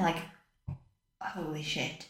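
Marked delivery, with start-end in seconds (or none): 1.2-2.1 very soft, hushed realisation; stunned inner thought. Retold or imitated. New mum realising she is alone with baby, hushed stunned voice. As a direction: say it under the breath, stunned.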